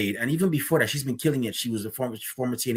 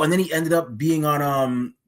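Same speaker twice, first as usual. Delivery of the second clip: ordinary talk, nothing marked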